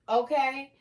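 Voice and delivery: usual speaking voice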